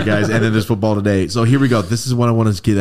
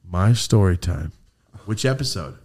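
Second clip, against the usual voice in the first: usual speaking voice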